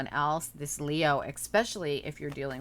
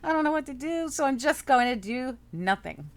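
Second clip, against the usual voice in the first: higher, lightly voiced helpless shrug; voicing a stuck client; 6 semitones higher, 3 dB louder than usual. Reader voicing the stuck Virgo client, mock helpless. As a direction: play the querent who gives up. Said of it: "Same exact energy of".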